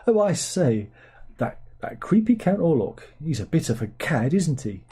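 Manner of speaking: imitating a posh Englishman calling Count Orlok a cad; mock posh English accent. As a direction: play a hammed-up posh English gent gossiping. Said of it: "not very authentic when they're all speaking with".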